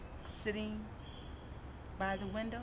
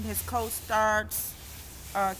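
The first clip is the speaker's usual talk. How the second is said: ordinary delivery, nothing marked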